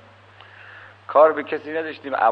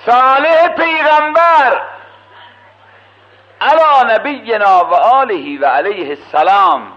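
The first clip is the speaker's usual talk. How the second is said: high, loud sermon cry building to the crowd; a preacher's ringing call; full-force preaching; 7 semitones higher, 8 dB louder than usual. Acted. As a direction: preach it out over the congregation. Preacher tells prophet's challenge.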